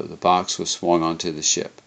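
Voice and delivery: ordinary delivery, nothing marked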